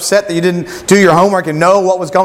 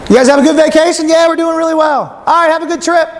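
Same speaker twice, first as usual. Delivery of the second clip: mock yell down; 9 semitones higher than usual. Friends chatting from cliff to river, mock calling out. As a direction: trade pleasantries pitched up across a distance. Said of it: "You just yell down".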